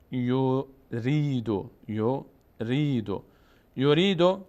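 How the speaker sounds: یوریدو said slowly as "yu-ree-du", long ee, last vowel sounded; final damma voiced. Shown as a demonstration. Teacher shows a word ending in damma, final vowel voiced. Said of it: "یا اگه ضمه بود آخر حرف".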